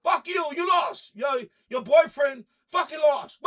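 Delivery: shouting. Real, not acted. Yelling at the TV after the loss, real shout. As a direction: hurl it at the losing side.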